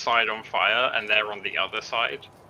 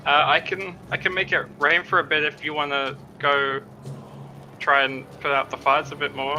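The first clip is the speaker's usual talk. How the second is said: raised, higher call to a crowd; 6 semitones higher, 3 dB louder than usual. Acted. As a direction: call out to everyone nearby. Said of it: "shout to everyone".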